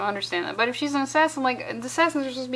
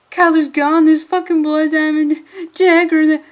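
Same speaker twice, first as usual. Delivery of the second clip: loud, higher hammed-up panic; mock panicked yell; 5 semitones higher, 8 dB louder than usual. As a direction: play the frantic character, overdone. Hamming up comic character's panic.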